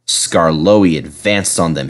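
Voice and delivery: usual speaking voice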